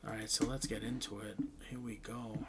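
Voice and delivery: low, very soft, sinking into a whisper; quick hushed start, whispered at the end; hushed spooky lead-in. Pretend. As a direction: murmur it as the lights go down. Lights down, setting a scary mood.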